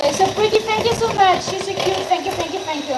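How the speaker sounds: thrilled thank yous; delighted thanks. Real, not acted. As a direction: gush over a lovely gift. Thanking a viewer for gifts.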